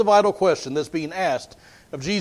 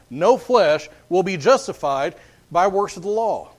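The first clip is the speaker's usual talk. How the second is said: ordinary talk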